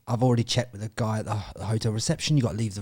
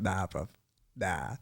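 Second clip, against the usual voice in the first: low, soft imitation of the mate; deadpan refusal; 4 semitones lower, 11 dB quieter, slower than usual. Mate's brother breaking bad news to him, low and deadpan. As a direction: let it drop low and quiet.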